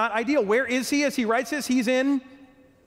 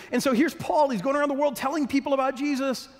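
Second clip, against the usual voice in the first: ordinary talk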